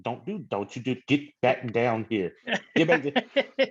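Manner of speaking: mock little kid scolding his own hand, rising and slowing; childish arguing. Talking about painting, squabbling with his hand like a little kid. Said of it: "arguing with my hand like a little kid".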